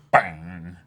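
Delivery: loud spoken bang effect; mock bang. Fan acting out the panel, loud bang. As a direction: punch out the sound effect.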